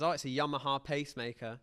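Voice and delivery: usual speaking voice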